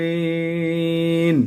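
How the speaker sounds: দলিন drawn out very slowly with a heavy দ; slow heavy dallin. Shown as a demonstration. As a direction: stretch দলিন slowly, no long a after দ. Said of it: "আমি দা কিন্তু বলি নাই". (খুব ধীরে টেনে দলিন)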